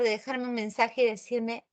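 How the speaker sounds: ordinary talk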